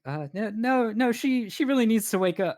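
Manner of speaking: high, urgent elven voice; insistent young elf. Acted. Young elven man overruling passengers who say let her sleep, insistent. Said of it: "young elven man is like".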